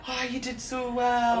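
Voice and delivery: high, drawn-out congratulating coo; imitated gushing praise. Imitating friends praising a girl after her performance, gushing. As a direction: gush slowly, as proud friends would.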